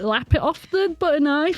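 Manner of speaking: high, coaxing. Acted. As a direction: tempt her with it. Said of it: "I'm saying, like".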